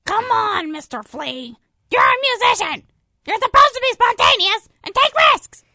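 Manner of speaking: loud, eager coaxing; eager urging. Acted. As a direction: egg him on loudly. Fly urges Flea loudly.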